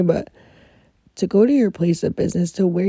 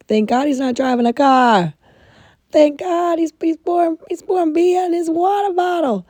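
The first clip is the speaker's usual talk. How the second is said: higher, louder, rising panic; imitating her own freak-out over a coworker drinking beer at work; 10 semitones higher, 5 dB louder than usual. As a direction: retell the shock of a coworker's beer bottle, voice climbing. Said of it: "I was freaking".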